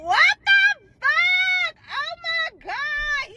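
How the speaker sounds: very high, slow stretched shriek of shock; drawn-out high horrified cry. Retold or imitated. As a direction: wail it in sheer disbelief. Learns he sent the video.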